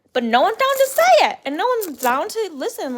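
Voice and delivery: exasperated outburst. Real, not acted. Complaining that nobody answers, exasperated high outburst. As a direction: voice jumps high and loud in frustration.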